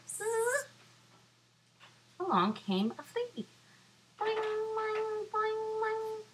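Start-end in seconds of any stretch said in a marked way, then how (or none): 0.1-0.6 buzzing fly. Acted. 4.2-6.2 high boing sounds. Acted. Flea bouncing sound.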